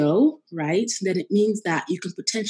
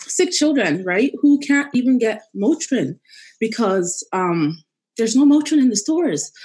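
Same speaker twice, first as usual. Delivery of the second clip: crying while talking, voice raised; blubbering; 4 semitones higher, 4 dB louder than usual. Real, not acted. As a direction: push the words out through tears. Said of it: "I'm sorry I'm crying".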